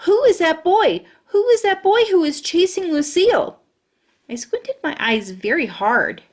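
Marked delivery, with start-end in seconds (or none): 0.1-3.6 high, loud alarmed shout; alarmed yell. Acted. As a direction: demand to know who the boy is. Grace alarmed, calling out.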